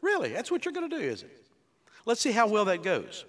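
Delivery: mock-incredulous Really, pitch swooping high; God mocking the tower builders, sarcastic; sarcastic Lord. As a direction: tease the builders with dry sarcasm. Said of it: "The Lord said".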